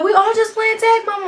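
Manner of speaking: high, loud shouted explanation; calling to mama. Retold or imitated. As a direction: call across the room to mama, imitating.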